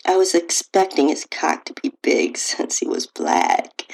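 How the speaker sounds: plain reading voice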